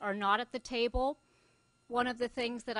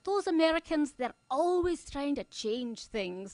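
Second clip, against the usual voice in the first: put-on Scottish accent; 4 semitones higher, 3 dB louder than usual. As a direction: put on a broad Glasgow accent and stretch it out. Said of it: "I can do the accent".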